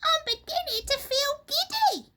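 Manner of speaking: very high, loud hag wail; giddy Missus Twit. A narrator's reading. Missus Twit feeling dizzy, high frightened wail.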